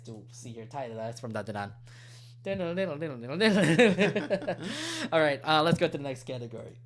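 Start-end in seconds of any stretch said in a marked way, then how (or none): 2.4-5.1 bouncy "den-nen-nen-nen" tune imitation breaking into laughter; hummed catchy riff. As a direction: sing the riff in nonsense syllables, then laugh.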